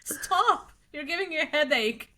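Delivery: high mock-exasperated mom pleading stop; hammed-up annoyed mother. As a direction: play the fed-up mother, lightly. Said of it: "My mom was like".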